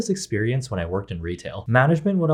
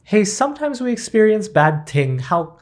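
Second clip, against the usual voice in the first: louder, higher fumbling delivery; awkward stuttering try; 8 semitones higher, 4 dB louder than usual. Pretend. Trying to comfort someone and fumbling, awkward and high. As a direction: botch the line like a nervous friend. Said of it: "I would definitely stutter and embarrass myself".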